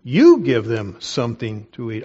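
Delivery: YOU said loud and drawn up, the rest dropping; stress on YOU. Shown as a demonstration. Preacher showing how the Greek should be read, stress on YOU. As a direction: hit YOU hard at the start. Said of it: "That's how it should be read".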